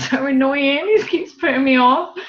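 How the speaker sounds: being tickled. Real, not acted. Still being tickled by son.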